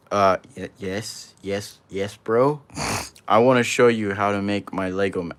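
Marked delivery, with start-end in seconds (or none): none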